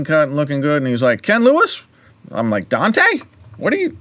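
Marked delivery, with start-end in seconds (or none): 1.2-1.8 surprised. Retold or imitated. Old friend spots him in lobby. 2.7-4.0 surprised. Retold or imitated. Recognizing old friend unexpectedly.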